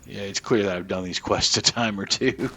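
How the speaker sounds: ordinary talk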